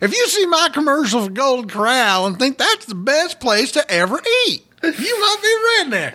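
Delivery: mocking a southern comedian's ad; Jeff Foxworthy impression. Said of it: "Jeff Foxworthy".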